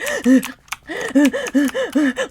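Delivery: a sharp, high gasp of panic; panicked gasp. Retold or imitated. Retelling her panic attack to viewers, high gasp. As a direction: gasp like you can't get air. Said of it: "and I'm over here like".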